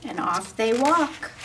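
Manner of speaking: plain reading voice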